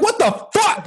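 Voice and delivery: high-pitched disbelieving cry; shocked. Retold or imitated. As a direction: squeak out the shock. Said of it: "I'm like".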